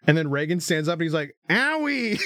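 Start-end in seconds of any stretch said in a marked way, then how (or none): 1.5-2.2 put-on high, babyish "owie"; a high mock-pained owie; mock hurt whine. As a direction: squeak it like a fake wounded kid. Reagan voiced after being slashed.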